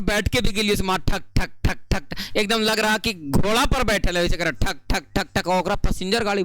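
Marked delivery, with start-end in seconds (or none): 1.0-2.4 rhythmic, repeated "thak" beats; imitated jolting noise. Teacher recounting a jugaad ride to students, imitated jolting noise. 4.5-5.5 evenly repeated "thak" beats; imitated horse-like jolting. Teacher likening the ride to horseback, imitated jolting noise.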